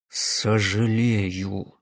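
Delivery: slow, low, forced through gritted teeth. Acted. Карпас цедит Гунькину сквозь зубы, медленно и низко. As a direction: grind the word out between clenched teeth. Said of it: "сквозь сцепленные зубы процедил Карпас".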